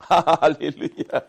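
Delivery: hallelujah breaking out of a laugh; laughing praise. Real, not acted. Preacher praising God mid-sermon, laughing.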